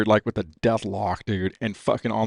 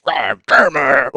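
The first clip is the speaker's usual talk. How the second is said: mock angry curse; 3 semitones higher, 7 dB louder, much slower than usual. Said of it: "just like".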